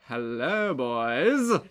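put-on slow, showy "hello, boys"; drawn-out greeting. Shown as a demonstration. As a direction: stretch out "hello" and land on "boys". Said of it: "and more of a".

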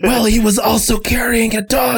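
high-pitched, hammed-up adoration; gushing fan. Crowd praising the man who saved grandma, gushing. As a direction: gush like a starstruck onlooker.

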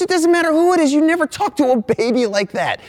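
hammed-up high-pitched angry mom; outraged mom. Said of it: "And she goes".

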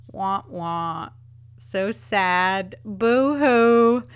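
mock whimpering wah wah; hammed-up boo-hoo sobbing; fake crying. Mock lament over garden season.

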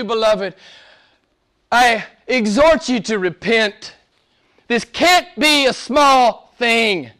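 high-pitched preaching, building in fervour; impassioned preaching. Real, not acted. Preacher exhorting the beloved to worship Christ, impassioned and high. Preaches scripture with rising force.

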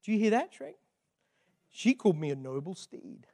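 lightly voiced donkey, a touch amazed; softly awed donkey, letting it sink in; donkey's dawning wonder. Donkey confiding in Shrek after princess's praise, mild amazement. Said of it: "the donkey stops and goes".

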